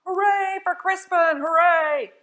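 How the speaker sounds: high, loud cheer. Acted. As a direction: shout hooray with joy. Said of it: "everyone shouted".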